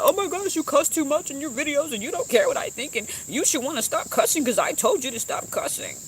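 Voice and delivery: high-pitched mock scolding voice; nagging critic. Viewer scolding the YouTuber for cussing, high mock nagging.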